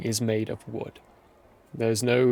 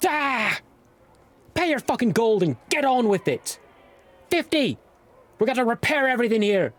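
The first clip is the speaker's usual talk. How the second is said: high, fast, cursing dwarf rant; angry old dwarf; 13 semitones higher, faster than usual. Acted. Dwarf scolding the late arrivals, high fast angry rant. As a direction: bark at them like a grumpy old dwarf.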